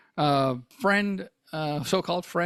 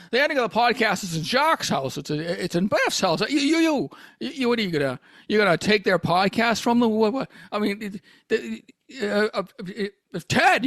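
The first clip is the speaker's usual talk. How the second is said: Jimmy Stewart impression; 2 semitones higher than usual. Host as Jimmy Stewart begging hosts to keep the podcast going. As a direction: stutter and plead with the hosts. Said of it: "I can't get out of the voice".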